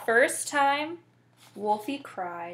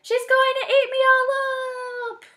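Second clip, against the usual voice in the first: high, loud, terrified wail; panicked cry; 7 dB louder than usual. A narrator's reading. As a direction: wail it out like a scared bear. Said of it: "cried the bear".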